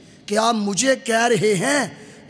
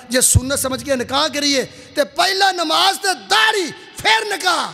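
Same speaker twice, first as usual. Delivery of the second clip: emphatic preaching; 6 semitones higher, 3 dB louder than usual. Real, not acted. Preacher putting prayer and beard before marriage, building to a shout. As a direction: build the admonition to a ringing peak.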